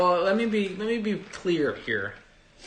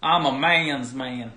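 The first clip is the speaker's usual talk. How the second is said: put-on louder, slow southern accent; hammed southern accent repeat; 7 dB louder, slower than usual. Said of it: "my southern accent?".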